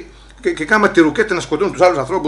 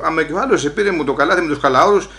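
usual speaking voice

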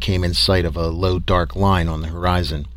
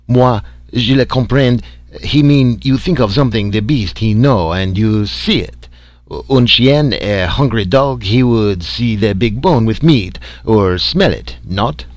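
put-on louder, eager French-accented English; 2 semitones higher, 5 dB louder than usual. A narrator's reading. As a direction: explain it like an excited Frenchman. Frenchman explains in broken English.

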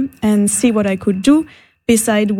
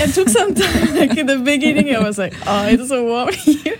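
amused, laughter breaking into the words; laughing recollection; 4 semitones higher than usual. Real, not acted. Guest telling host about arriving in the heat, laughing. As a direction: talk through giggles, higher at first.

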